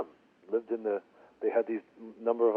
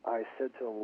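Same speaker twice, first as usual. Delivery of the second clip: ordinary talk, nothing marked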